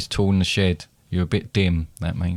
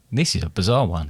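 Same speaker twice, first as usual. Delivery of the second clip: normal talking voice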